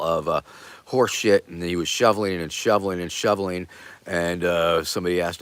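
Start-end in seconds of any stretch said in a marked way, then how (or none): none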